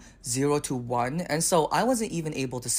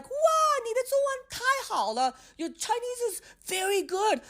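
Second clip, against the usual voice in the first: much higher, sing-song gushing compliment; gushing praise. Retold or imitated. Clerk gushing over a foreigner's Chinese. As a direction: overdo the amazed praise. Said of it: "she would be like".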